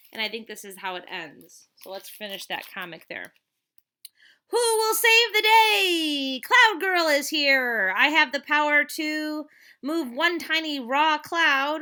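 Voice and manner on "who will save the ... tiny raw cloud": high, loud, slow superhero boast; hammed-up superhero entrance, loud and slow; heroic announcer. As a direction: proclaim like a tiny hero.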